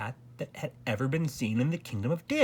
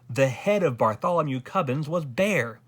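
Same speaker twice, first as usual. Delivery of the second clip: plain reading voice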